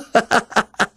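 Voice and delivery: high gleeful cackle of a madman. Acted. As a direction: let a giddy mad laugh escape. Madman laughs at his cleverness.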